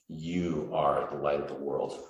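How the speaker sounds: you said slowly with weight on "you"; slow, deliberate stress on "you"; emphatic you. Shown as a demonstration. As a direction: lean hard on "you" and draw it out. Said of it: "That's an emphatic "you".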